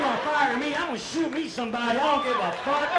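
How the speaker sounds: loud, ranting threats; hammed-up furious rant. Fired man raging at his boss, loud mock fury. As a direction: bellow it like a man unhinged.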